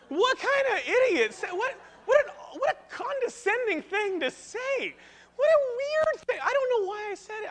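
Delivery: incredulous. Real, not acted. Pastor mocking his own blunder, high incredulous. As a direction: squeal in disbelief at yourself.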